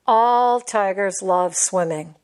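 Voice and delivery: plain reading voice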